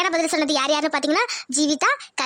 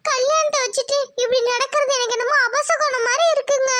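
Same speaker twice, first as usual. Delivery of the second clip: higher, anxious village wife; worried wife; 8 semitones higher than usual. Acted. Wife telling husband her omen fears, anxious. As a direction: voice your fear of a bad omen.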